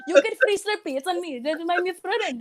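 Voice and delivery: high-pitched hammed-up accent; mock-accent friendly offer; put-on foreign shopkeeper. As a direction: play a generous accented shopkeeper.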